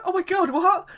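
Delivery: loud, high-pitched astonishment; acted disbelief. Fans told to just go in, acted loud disbelief. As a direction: blurt it high and loud, reenacting shock. Reenacts surprise at no queue.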